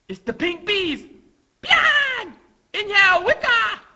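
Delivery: very high, loud little voice; high, hammed-up rallying cry; excited battle call. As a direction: shout the signals in a squeaky cartoon voice. Acted small fighters calling out.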